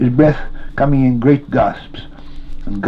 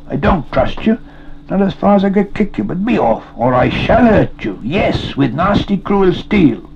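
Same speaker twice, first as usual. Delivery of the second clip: high-pitched, indignant warning; raised, exasperated telling-off; scolding threat; 9 semitones higher than usual. A narrator's reading. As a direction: threaten him off, throwing his words back. Sam scolds, mocks Gollum's words.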